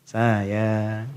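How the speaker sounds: low, coaxing mock tender "Sayang"; sweet wife voice. Wife greeting husband with smile. (istri merayu suami dengan suara manja)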